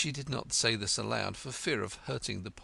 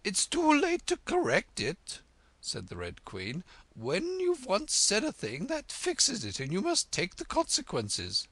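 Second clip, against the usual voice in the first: imperious Red Queen; 12 semitones higher, 5 dB louder than usual. Acted. Red Queen testing Alice on French, high and haughty. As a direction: quiz her grandly, drawing out fiddle-de-dee.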